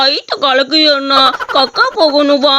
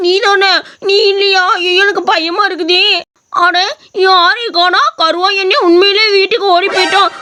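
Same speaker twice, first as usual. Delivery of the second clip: ordinary delivery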